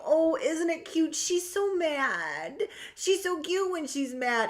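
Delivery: patronizing cutesy voice mocking an upset woman; condescending cooing. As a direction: coo down at her like a toddler. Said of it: "somebody go".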